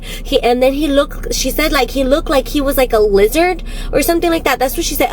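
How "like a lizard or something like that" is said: ordinary talk, nothing marked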